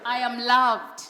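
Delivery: loud, firm declaration for the crowd to echo; with conviction. Shown as a demonstration. Speaker leading an audience in affirmations, loud and firm. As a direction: call out each affirmation so they repeat it. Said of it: "Please say this with conviction".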